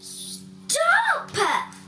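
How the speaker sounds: a high, ringing shout of stop; loud cry. Acted. Father halting the brawl on stage with a high shout. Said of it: "cried a very loud voice".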